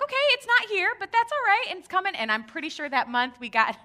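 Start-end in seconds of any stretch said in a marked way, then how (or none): 0.0-2.1 high, cheery brave face; hopeful. Retold or imitated. Couple waiting for the money, high cheery hope. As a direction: keep the hope up, pitched high. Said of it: "we're like".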